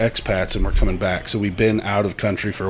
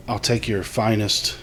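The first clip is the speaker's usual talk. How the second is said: put-on English accent; 7 dB quieter, slower than usual. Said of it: "Let's do a English accent off".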